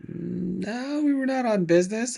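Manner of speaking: high-pitched mock protest; indignant denial. Traveler denying business trip to officer, mock indignant. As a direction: deny it with exaggerated innocence, voice rising.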